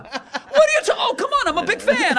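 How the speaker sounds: high, loud squealing backpedal; mock terrified high whine; panicked fan. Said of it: "Joe Rogan immediately Kimuras you".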